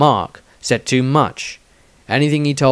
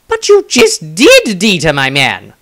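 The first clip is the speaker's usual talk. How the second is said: cocky wizard; 5 semitones higher, 7 dB louder than usual. Acted. Maestro teasing Dieter.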